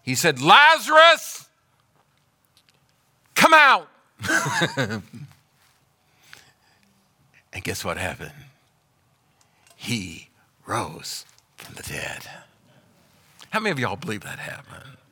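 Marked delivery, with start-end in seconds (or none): 0.4-3.9 very high, loud, slow call; a ringing shout into the grave; loud command. Acted. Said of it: "And with a loud voice". 7.5-12.3 quiet awed telling; hushed reveal. Acted. Hushed reveal after shout.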